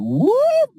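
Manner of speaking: very high, loud whoop of delight; joyful whoop. Acted. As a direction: let out a wild, giddy whoop. Batman loses his mind over popcorn.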